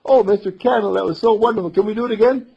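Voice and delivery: put-on high, loud child voice; excited little boy. Boy wakes and calls Mister Candle, excited high voice. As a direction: burst out with the news in a boy's voice.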